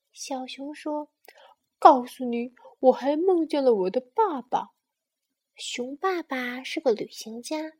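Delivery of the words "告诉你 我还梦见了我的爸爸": excited announcing. Acted. Bear proudly announces another dream.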